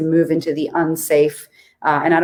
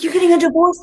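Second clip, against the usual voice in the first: high, thrilled mock-delighted squeal; high excited coach cheering on a divorce; 12 semitones higher, 3 dB louder, faster than usual. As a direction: gush over their divorce like it's great news.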